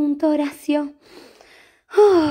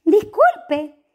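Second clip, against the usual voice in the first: polite cat voice; 9 semitones higher, 4 dB louder than usual. Acted. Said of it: "le preguntó".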